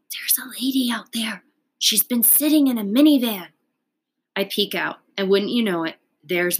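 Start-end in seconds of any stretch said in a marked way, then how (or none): 0.1-3.5 higher whispered warning that swells louder; little sister whispering from the window; urgent whisper. Acted.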